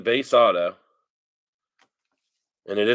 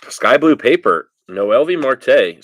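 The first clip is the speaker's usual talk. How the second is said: much louder, higher, thrilled; excited; 3 semitones higher, 10 dB louder than usual. Real, not acted. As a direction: announce a big pull. Pulling a rare parallel card.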